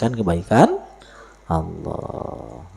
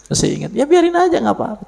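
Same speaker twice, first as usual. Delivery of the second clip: high excited; 6 dB louder, faster than usual. Real, not acted. High joking exclamation.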